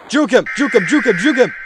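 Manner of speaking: high, loud frantic chant; urgent shouting. Real, not acted. Gamer dodging the monster chasing him, frantic high shouting. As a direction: fire it out repeatedly in a panic.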